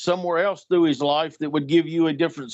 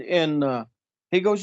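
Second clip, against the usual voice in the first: normal talking voice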